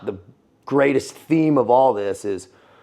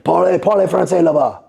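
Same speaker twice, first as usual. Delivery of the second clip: barking officer; 7 semitones higher, 5 dB louder than usual. Retold or imitated. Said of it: "Speak French over there".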